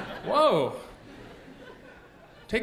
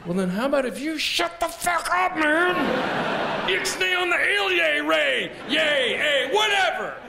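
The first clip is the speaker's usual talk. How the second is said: higher, shouting rant; mock angry rant; 5 semitones higher than usual. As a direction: yell at the TV. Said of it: "ranting at the news".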